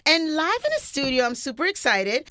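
upbeat on-air welcome; radio host intro. Acted. Radio host opening the Sunday show, upbeat announcer. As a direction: greet the listeners like a radio host.

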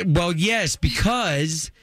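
usual speaking voice

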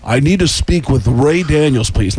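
slow, drawn-out rock star impression. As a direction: put on a self-important star's phone voice. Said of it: "I prank called them as [name]".

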